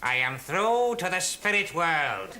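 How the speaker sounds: slow, drawn-out séance voice; entranced medium. Acted. Medium reports contact with spirit world, slow trance voice. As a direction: intone it slowly from a trance. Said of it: "he's in a trance".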